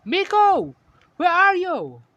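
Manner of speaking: loud, high desperate call; shouting a name. A narrator's reading. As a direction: shout out for a missing little sister. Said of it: "try to shout her name".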